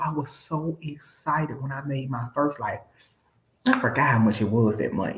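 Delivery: normal talking voice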